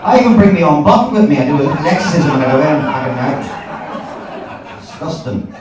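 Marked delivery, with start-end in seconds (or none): none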